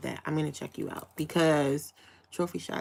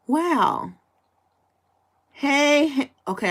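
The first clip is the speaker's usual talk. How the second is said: normal talking voice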